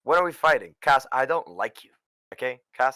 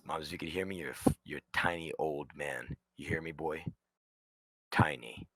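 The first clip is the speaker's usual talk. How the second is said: quiet taunt; 9 semitones lower, 12 dB quieter than usual. Acted. Taunting absent host quietly.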